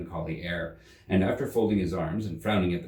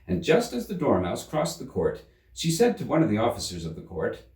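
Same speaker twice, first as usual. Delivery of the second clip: ordinary delivery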